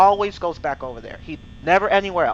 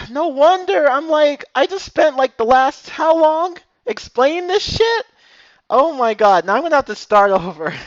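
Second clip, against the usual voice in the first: exasperated rant; 9 semitones higher, 7 dB louder than usual. Real, not acted. Ranting about wasted explanation.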